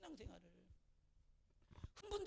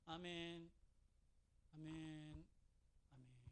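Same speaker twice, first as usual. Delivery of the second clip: fading amen; 10 semitones lower, 10 dB quieter, much slower than usual. Shown as a demonstration. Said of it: "이게 이래 돼요. 점점점".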